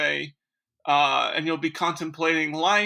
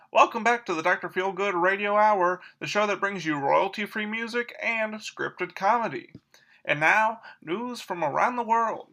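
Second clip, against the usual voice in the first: lilting vintage announcer read; bouncy newsreel-style delivery; old-time radio host; 4 semitones higher than usual. Acted. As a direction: announce like a 1940s radio emcee.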